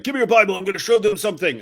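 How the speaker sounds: a retold grab, voiced pushy and high; imitating a demand. Demanding a woman's Bible, retold with a pushy voice.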